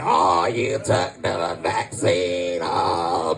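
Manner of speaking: very low, slow drawn-out jeer; mock shaming. Comic mimics vaccine shamers, low and drawn-out. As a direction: drag it out deep to shame them. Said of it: "They be out here shaming people for vaccinating".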